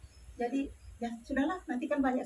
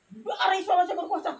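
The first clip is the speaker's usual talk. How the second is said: shouted prayer; 5 semitones higher than usual. Retold or imitated. Wife shouting a prayer over her dead husband. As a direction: cry out the prayer with everything.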